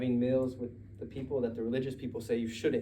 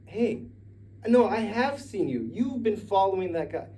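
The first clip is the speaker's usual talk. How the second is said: put-on accusing servant girl; 8 semitones higher, 5 dB louder than usual.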